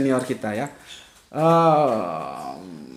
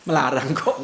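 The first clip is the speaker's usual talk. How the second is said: normal talking voice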